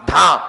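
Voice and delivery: "ధ" as a d with a puff of breath; aspirated dha. Shown as a demonstration. Teacher shows how da gets aspirated, breathy "ధ". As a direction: release breath right after the d in "ధ". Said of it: "దాని ఎట్లా పలుకుతాం?".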